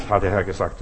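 usual speaking voice

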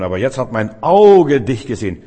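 Auge dich gesehen thrown out loud in triumph; proclaiming loudly. Real, not acted. Preacher proclaiming Job's words to the congregation, loud and triumphant. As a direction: proclaim it with full force, voice ringing.

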